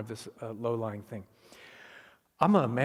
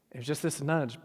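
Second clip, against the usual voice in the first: normal talking voice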